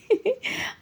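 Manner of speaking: short high-pitched giggle; a quick bright burst of laughter. Real, not acted. Vlogger laughing at her own story, high giggle.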